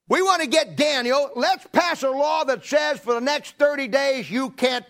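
high, loud scheming cry; scheming shout. Acted. Conspirators plotting against Daniel, shouting gleefully.

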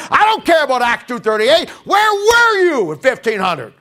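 shouting back at a cultist, high and loud; a preacher's mock shouting match with a false teacher; heated argument. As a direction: fire back hard at the doubter.

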